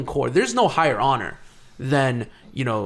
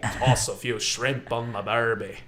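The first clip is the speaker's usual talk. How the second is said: slow, low Aussie parody; mocking Australian accent; 5 semitones lower, 4 dB quieter, slower than usual. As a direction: drag out a bad Australian accent, lower and softer. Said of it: "that's how you sounded".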